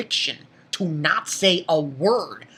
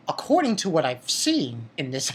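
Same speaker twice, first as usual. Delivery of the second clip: usual speaking voice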